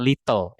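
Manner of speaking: T sounded in little, as the wrong take; little with full T. Shown as a demonstration. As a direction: say "lit-tol" with a clear T. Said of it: "Jadi bacanya bukan".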